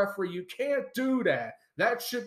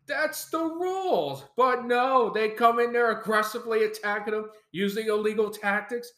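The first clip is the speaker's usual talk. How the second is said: ordinary delivery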